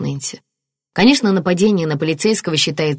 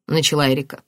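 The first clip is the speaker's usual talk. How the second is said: ordinary delivery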